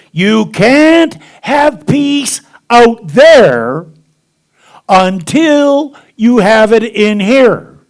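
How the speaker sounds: loud, slow, high and deliberate; emphatic preaching. Real, not acted. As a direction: drive the point home slowly and loudly. Preaching the core message.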